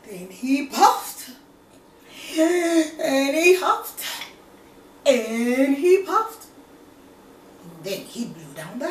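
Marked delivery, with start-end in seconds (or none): 0.1-6.5 slow, loud, rising huffing and puffing; dramatic huffing. A narrator's reading. Narrating the wolf's blowing.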